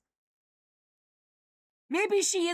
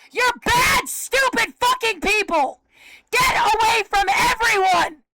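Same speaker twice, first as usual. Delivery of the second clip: furious screaming; 4 semitones higher than usual. Real, not acted. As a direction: spit every curse out at them. Screaming curses at the cult.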